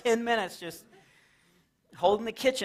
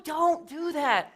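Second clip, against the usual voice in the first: slow creepy warning; 8 semitones higher, much slower than usual. Retold or imitated. Scolding a sneaky kid.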